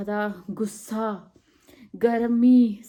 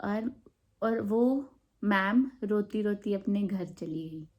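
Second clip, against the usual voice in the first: ordinary talk, nothing marked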